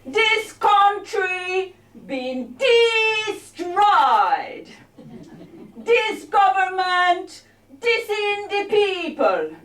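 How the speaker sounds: slow, high, chanted mock Jamaican verse; Rastamouse patois. Comic performs a Rastamouse-inspired poem, mock Jamaican patois. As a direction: declaim it in put-on patois, stretching each "dis". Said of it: "here is my Rastamouse-inspired poem".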